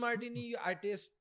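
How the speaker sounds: ordinary talk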